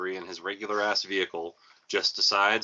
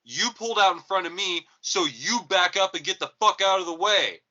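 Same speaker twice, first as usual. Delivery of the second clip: retold furious shout, raised and loud; angry yelling; 9 semitones higher, 5 dB louder than usual. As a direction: yell at the driver who cut you off. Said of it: "Until he said".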